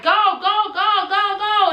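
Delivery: high, loud panicked yelling; desperate high-pitched go go go; frantic urging. Retold or imitated. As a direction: shout at her to floor it. Urging granny through yellow light.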